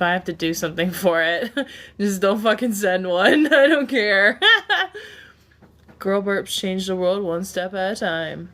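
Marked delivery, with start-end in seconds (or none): 4.5-5.1 burst of laughter. Real, not acted. Laughing at own joke.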